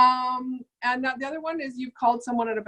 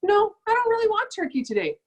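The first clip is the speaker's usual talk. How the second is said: customer saying no; 12 semitones higher than usual. Retold or imitated. Customer declining turkey.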